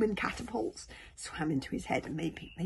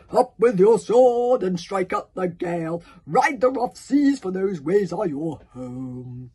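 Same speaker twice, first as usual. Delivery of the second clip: Valhallarama; 3 semitones higher, 15 dB louder, slower than usual. Acted. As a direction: chant grandly like a magnificent commander. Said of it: "impression of Valhallarama's booming, magnificent tones".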